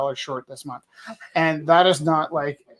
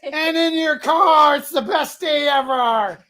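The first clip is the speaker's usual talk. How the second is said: excited; 10 semitones higher, 5 dB louder, slower than usual. Real, not acted. Delighted pitch to bidder.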